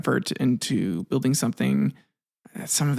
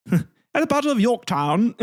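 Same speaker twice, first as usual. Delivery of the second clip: higher-pitched mock Cockney; British, Cockney accent; 9 semitones higher than usual. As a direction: put on a high British accent. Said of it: "it's probably more British".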